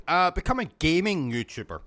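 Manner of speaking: ordinary delivery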